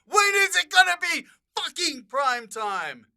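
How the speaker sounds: high-pitched exasperated outburst; furious, high-pitched mock tirade; angry rant. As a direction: rant like you've lost it in a meeting. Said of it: "this is what you were like in network meetings".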